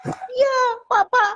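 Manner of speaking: put-on very high, drawn-out little kid voice; mock-whiny kid, slow and high; child's complaint. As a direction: imitate a child tattling tearfully. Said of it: "dia bilang".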